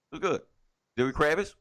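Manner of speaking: ordinary delivery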